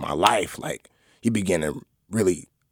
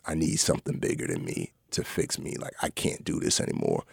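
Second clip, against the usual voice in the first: much lower, softer whispered plea; hushed prayer; 10 semitones lower, 9 dB quieter than usual. Retold or imitated. Praying at the altar for help, hushed whispered plea. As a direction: murmur it like a desperate prayer.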